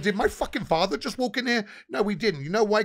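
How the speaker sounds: high-pitched indignant questioning; retold outraged retort.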